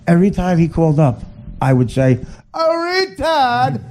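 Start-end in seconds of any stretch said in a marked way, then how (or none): 2.5-3.8 much higher, slow stretched greeting; mock drawn-out call. Comic imitating how he greeted the caller. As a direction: drag it out, teasing.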